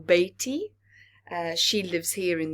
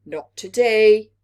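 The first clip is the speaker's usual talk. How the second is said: louder, firm refusal; firm parent; 3 semitones higher, 14 dB louder than usual. Acted. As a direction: shut it down kindly but firmly. Parent refuses Little Earth.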